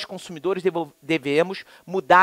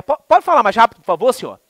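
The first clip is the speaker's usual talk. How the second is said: rude clerk; 4 semitones higher, 5 dB louder, faster than usual. Retold or imitated. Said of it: "aquele cara é estúpido, grosso".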